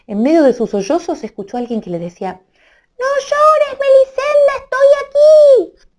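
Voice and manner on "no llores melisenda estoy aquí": very high, small little-man voice; tiny voice. Acted. Tiny Amadís comforting crying Melisenda from her ear: very high squeak.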